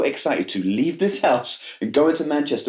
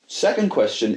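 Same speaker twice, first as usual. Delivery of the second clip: usual speaking voice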